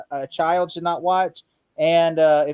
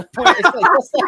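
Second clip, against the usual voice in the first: high, slow, laughing hard; can't finish for laughing; laughing through words; 11 semitones higher, 5 dB louder, slower than usual. Real, not acted. Mocking the assassin's outfit while cracking up.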